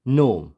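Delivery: नोम as one syllable, no g; correct, silent g. Shown as a demonstration. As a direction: say नोम, starting on n and ending on m. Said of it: "ये सही pronunciation क्या होगी".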